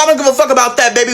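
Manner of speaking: ordinary delivery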